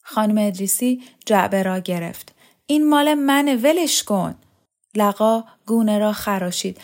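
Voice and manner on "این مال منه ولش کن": raised, possessive protest; cross. A narrator's reading. Mother taking back her music box, cross. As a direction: claim it back firmly.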